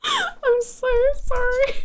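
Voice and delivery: sheepish squeaky apology; high drawn-out sorry. Real, not acted. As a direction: stretch the sorry up high and slow. Laughing apology to chat.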